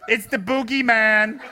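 slow, loud joking menace; hammed-up boogeyman reply; mock spooky reply. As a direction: tease through the door. His reply at the door.

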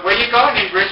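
loud, high challenge to someone sneaking past; calling out sharply. Retold or imitated. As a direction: call her out, loud and high. Calls out to catch her sneaking.

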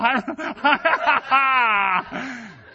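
high, loud laughing cackle; hammed-up giggle fit; mock cackling laugh. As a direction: laugh like the giddy incense guy. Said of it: "sitting there like".

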